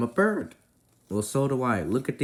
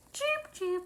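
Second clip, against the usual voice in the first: a much higher, tiny squeaked cheep cheep; squeaky bird cheep; 6 dB quieter than usual. Pretend. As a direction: peep it like a baby chick, way up high. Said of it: "Why are you barking at me?".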